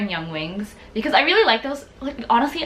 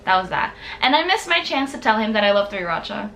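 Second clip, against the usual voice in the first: ordinary talk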